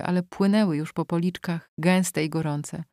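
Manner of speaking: usual speaking voice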